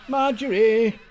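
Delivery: loud, high call to the next room. Acted. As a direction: summon the secretary. Mayor calling to secretary.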